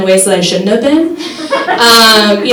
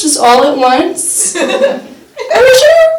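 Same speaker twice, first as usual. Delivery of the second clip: high doubtful voice; 10 semitones higher, slower than usual. Retold or imitated.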